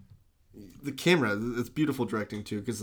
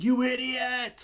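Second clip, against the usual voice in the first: high, drawn-out mock yell; slow, high-pitched cartoonish outrage; mock fist-shaking; 13 semitones higher, much slower than usual. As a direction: yell it like a fist-shaker, hammed up. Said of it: "he's shaking his fist at".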